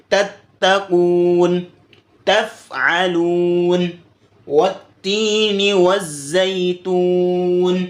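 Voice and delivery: تتقون recited very slowly, ta thin yet firm; light but firm ta. Shown as a demonstration. Teacher shows how to read ta, thin and firm. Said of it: "اور آپ باریک پڑھیں گے مضبوطی سے".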